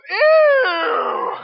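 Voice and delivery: high, loud "Ew" of disgust; disgusted yell. Retold or imitated. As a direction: yelp it like a grossed-out teenager. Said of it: "Isaac, the jerk, goes".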